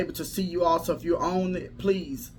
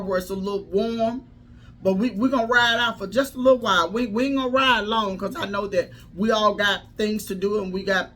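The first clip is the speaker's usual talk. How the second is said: rising, louder preacher cadence; preaching; 4 semitones higher, 3 dB louder than usual. Acted. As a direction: preach it with building fire. Preaching build-up to audience.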